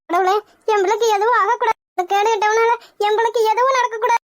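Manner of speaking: ordinary delivery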